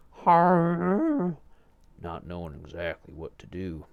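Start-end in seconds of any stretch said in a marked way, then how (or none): 0.1-1.4 very high, loud confused grunt; mumbled grunt. A narrator's reading. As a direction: blurt a clueless grunt.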